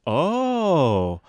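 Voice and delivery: long drawn-out 'oh' rising high then falling; surprised oh. Retold or imitated. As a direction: let a slow realization swell through it. Retells surprised reaction at poetry night.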